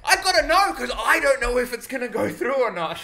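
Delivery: high, loud acted protest; громкий протест. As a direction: shout back in exaggerated self-defence. Acting out own panicked protest.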